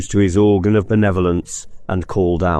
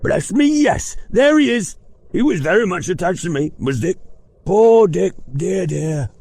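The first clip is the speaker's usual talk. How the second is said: put-on old Scrooge fond; 12 semitones higher than usual. Scrooge remembers Dick.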